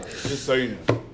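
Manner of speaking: ordinary delivery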